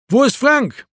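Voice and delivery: high-pitched shouted question; calling out. A narrator's reading. Erwin asking Marco for Frank, calling out. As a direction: demand it through the glass.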